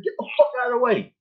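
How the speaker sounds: high, fast angry yell; retold angry yell. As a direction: bark it up high and quick at her. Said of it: "I said".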